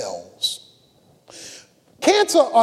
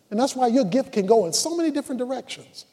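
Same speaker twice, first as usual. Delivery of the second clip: ordinary talk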